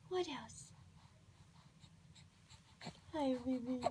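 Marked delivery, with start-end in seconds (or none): 0.1-0.7 soft unvoiced whisper. Real, not acted. Repeats question, whispered.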